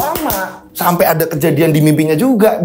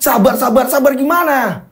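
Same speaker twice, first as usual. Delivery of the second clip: irritated retort; 7 semitones higher than usual. Acted. Angry customer retorts.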